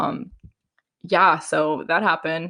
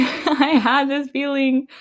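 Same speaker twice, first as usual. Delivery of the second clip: much higher and slow, laughing through it; laughing emphasis; 9 semitones higher, slower than usual. Real, not acted. Telling her followers about her gut feeling, laughing. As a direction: giggle out the feeling as if it was obvious. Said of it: "Guys, when I tell you I have this feeling".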